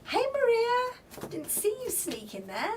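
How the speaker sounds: usual speaking voice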